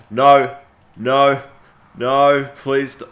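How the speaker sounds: begging the screen not to lose the work, loud and high; real panic. Real, not acted. Panics that the recording is lost.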